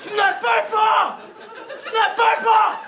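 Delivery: high, slow, loud frightened shout; panicked mother. Acted. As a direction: shout in terror for your child. Scared mother yelling.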